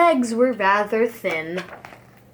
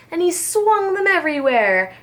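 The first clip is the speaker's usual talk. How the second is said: usual speaking voice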